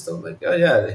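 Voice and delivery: normal talking voice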